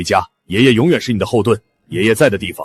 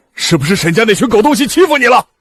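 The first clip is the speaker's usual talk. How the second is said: high-pitched, louder protective outrage; indignant outburst; 13 semitones higher, 4 dB louder than usual. Acted. Grandfather suspects his granddaughter was bullied, indignant outburst. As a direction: flare up in outrage on a loved one's behalf.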